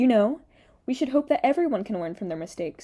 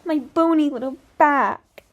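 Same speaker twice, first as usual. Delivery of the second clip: higher, louder, slow mock singer voice; exaggerated mocking imitation; 5 semitones higher, 6 dB louder than usual. Commentator mimicking the singer's little turn, loud hammed-up mockery. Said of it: "She's like".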